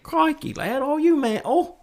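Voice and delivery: put-on high-pitched, muddled Aussie-British accent; mock Aussie accent. Co-host attempting British to outdo the host, high Aussie-sounding accent. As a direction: squeak out a badly faked Aussie accent. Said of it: "That was more Aussie than anything".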